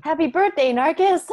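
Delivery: loud, high birthday cheer; celebratory shout. Pretend. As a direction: shout it out like a party surprise. Joking shout of birthday greeting.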